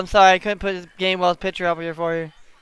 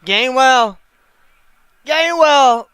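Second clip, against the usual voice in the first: loud, higher cry of the player's name; yelling at the screen; 4 semitones higher, 7 dB louder, much slower than usual. Real, not acted. Fan yelling a player's name at the TV. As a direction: call the name out at the TV.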